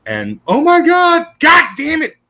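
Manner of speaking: ordinary talk, nothing marked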